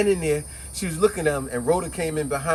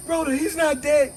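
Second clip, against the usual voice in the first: hysterical; 12 semitones higher, 4 dB louder than usual. Retold or imitated. Hysterical Annette.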